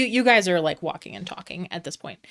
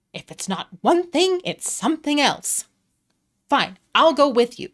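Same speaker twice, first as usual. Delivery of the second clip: much higher and louder, fed up; exasperated Mister Light; 8 semitones higher, 6 dB louder than usual. Acted. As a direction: throw up your hands, then give in. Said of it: "And Mister Light's like".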